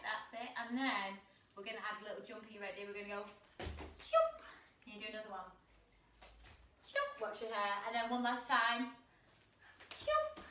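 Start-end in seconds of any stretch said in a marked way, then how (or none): 4.0-4.3 jump. Acted. Kids yoga frog jump cue. 10.0-10.4 jump. Acted. Kids yoga frog jump cue.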